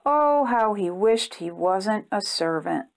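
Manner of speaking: plain reading voice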